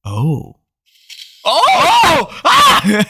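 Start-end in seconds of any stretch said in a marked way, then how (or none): 1.4-3.0 startled shout. Real, not acted. Drink almost spilled.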